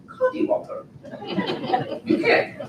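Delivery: playful, high-pitched British accent. Shown as a demonstration. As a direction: say it in a mock British lilt. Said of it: "say it with a British accent".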